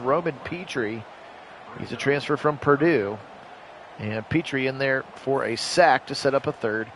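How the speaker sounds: normal talking voice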